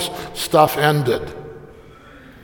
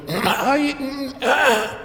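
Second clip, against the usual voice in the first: theatrical throat clearing; 8 semitones higher, 4 dB quieter than usual. Retold or imitated. Louis preparing to sing.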